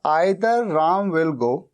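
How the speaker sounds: very slow, each word spaced; teacher dictating the English line slowly; slow dictation. Shown as a demonstration. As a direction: read it out slowly for students to write. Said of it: "teacher dictates the translation".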